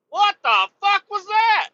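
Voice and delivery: high, loud startled outburst; jolted out of nowhere. Retold or imitated. Startled driver reacting to the bump, high loud outcry.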